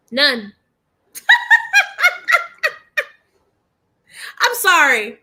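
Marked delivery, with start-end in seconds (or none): 1.2-2.7 a sudden high cackle of laughter; high burst of laughter. Real, not acted. Laughing out loud at the K-Nets. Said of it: "I'm sorry for laughing".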